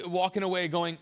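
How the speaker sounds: normal talking voice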